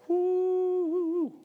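long, high sustained whoop; excited preacher's whoop; high "woo". Real, not acted. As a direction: let out a drawn-out, high woo. Preacher's own exclamation.

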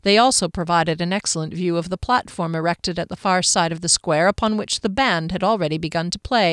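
ordinary delivery, nothing marked

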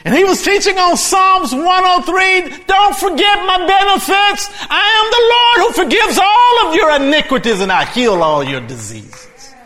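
high, shouted scripture proclamation; full-force preaching. Real, not acted. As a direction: proclaim it loudly to the whole church.

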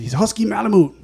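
put-on high-pitched surfer-slash-Mexican accent; Mexicali accent. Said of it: "like the surfer slash Mexican accent".

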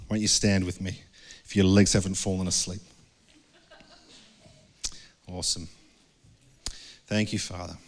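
closing prayer. Acted. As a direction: pray gently over the congregation.

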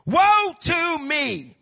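long, high, loud wail of woe; drawn-out shouted lament; anguished cry. Acted. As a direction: cry it out slowly at full voice. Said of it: "I cried".

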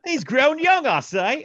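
very high, loud fake British; mock British accent. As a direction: pipe up in silly British. Joins the bad British accent game.

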